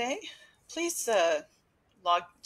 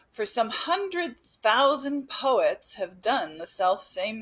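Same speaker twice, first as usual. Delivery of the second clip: slow, louder recital voice; poetry reading; 3 semitones higher, 5 dB louder than usual. Acted. Curator reading a spring poem to her viewers, slow recital. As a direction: savour each line like a recital. Reads Mary's poem aloud.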